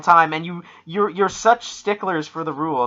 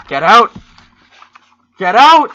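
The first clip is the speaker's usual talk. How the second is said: loud cry, pitch leaping higher; mock shout; 7 semitones higher, 12 dB louder than usual. Dealer ordering something out of the tarot cards, mock shout. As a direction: shout it as a theatrical banishment.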